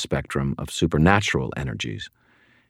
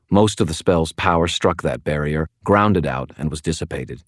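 plain reading voice

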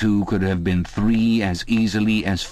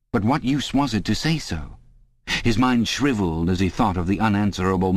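ordinary delivery, nothing marked

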